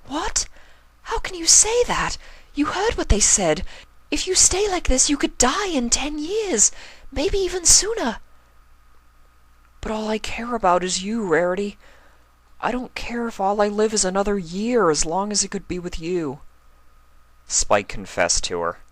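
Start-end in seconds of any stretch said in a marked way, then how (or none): 0.1-8.2 much higher, alarmed and distressed outburst; shocked Rarity. Acted. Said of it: "Rarity was shocked". 9.8-16.4 put-on much higher boyish voice, earnest confession; earnest Spike.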